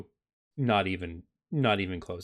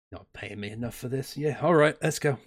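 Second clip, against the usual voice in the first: annoyed mercenary; 3 semitones higher than usual. Acted. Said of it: "Looks really annoyed".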